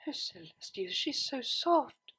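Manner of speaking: soft, slow, dazed murmur; dreamy murmur. A narrator's reading. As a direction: drift off into it, half asleep. Dazed, nuzzling her roommate.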